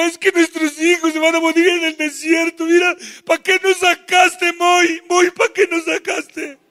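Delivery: high whining complaint; whiny lament. Acted. People whine to [name] about their children.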